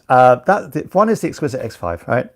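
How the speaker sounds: normal talking voice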